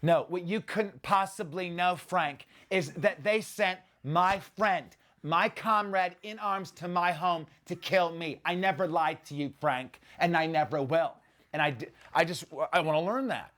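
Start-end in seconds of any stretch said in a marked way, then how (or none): 0.0-11.1 high, slow, deliberate villain line; Malkovich impression. Actor reciting a memorized Malkovich line, slow and high. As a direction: recite the Malkovich threat, drawn out. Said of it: "just learning Malkovich's line".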